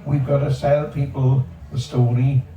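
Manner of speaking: ordinary talk, nothing marked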